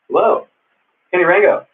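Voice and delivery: high, loud bright hello; excited greeting. Real, not acted. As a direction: call out the hello. Greeting a viewer in chat.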